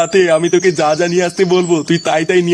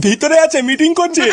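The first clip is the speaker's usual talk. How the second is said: startled high answer; 9 semitones higher than usual. Acted. Startled follower answers angry raiders.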